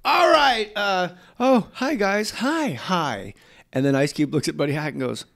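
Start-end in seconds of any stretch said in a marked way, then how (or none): 0.0-3.3 high, flustered hi guys; sudden nervous high-pitched hello; awkward startled greeting. Retold or imitated. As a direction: caught off guard, chirp the greeting high.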